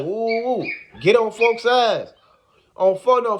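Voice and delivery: ordinary talk, nothing marked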